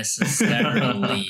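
"necessarily" drawn out slowly, syllable by syllable, amused; laboured sounding-out. Retold or imitated. Typing the hard adverb every time, "necessarily" sounded out slowly and laboriously. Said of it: "每次打字就在那边".

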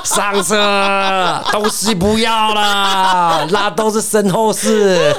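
急切地放声大喊，后面拖长放慢; 像在马路上隔着人群高喊让她快上车; 喊着催人上车. Retold or imitated. 骑车赶来救女友，大喊. (大声急喊，尾句放慢拖长)

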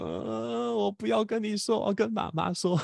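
put-on a small child sulking through tears; imitating son in tears. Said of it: "他就一直掉眼泪".

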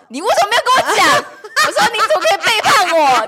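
hammed-up hurt protest; mock outrage. Scolding the sister for hiding her birthday, mock outrage.

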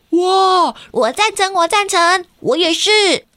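high, eager cheering; excited kids. Acted. Classmates cheering in story.

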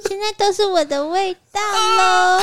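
high giggling lovey line; giggly cutesy. Retold or imitated. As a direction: giggle it out sweetly. Retold lovey line, laughing.

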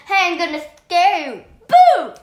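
high, loud mock warning building to a boo; attention-getting yell. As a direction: pretend to startle someone. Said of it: "you have to yell for attention".

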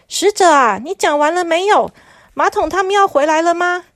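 lightly voiced bossy president; impatient president. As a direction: press for an answer. President calls messenger.

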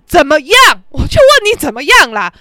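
loud, defiant challenge; defiant taunt. Retold or imitated. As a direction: throw it out daring a reply. Taunting after the shouted challenge. (大声挑衅)

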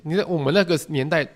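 usual speaking voice

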